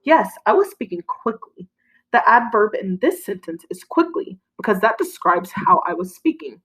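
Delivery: usual speaking voice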